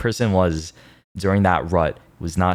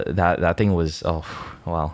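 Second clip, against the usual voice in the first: ordinary delivery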